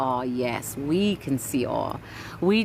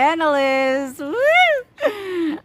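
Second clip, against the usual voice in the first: high, loud, thrilled outburst ending in a whoop; excited whoop; 11 semitones higher, 6 dB louder, much slower than usual. Real, not acted. Streamer cheering a viewer's comment, thrilled and loud.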